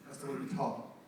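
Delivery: usual speaking voice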